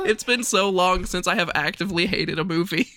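talking through real laughter; laughing. Real, not acted. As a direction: laugh while saying it.

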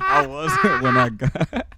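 slow, high, through laughter; giggling and stoned; laughing. Real, not acted. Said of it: "I'm so stoned right now".

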